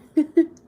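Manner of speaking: loud high laugh; burst of laughter. Real, not acted. Laughing at her own rant.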